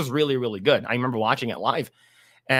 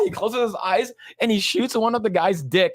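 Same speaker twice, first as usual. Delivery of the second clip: usual speaking voice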